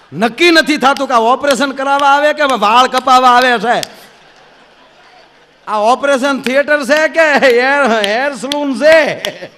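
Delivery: loud, high rant that slows down at the end; mock exasperated shout. Doctor ranting about the operation theatre, loud and slow. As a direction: yell it, then drag out the last words.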